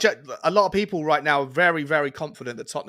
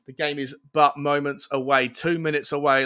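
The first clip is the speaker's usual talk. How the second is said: usual speaking voice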